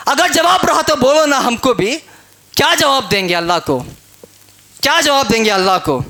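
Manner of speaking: ordinary talk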